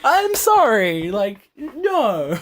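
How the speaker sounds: high, slow, laughing disbelief. Real, not acted. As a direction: laugh it out, amused. Laughing at the absurd game.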